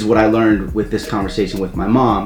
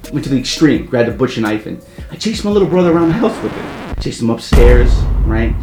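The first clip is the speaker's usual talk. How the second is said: normal talking voice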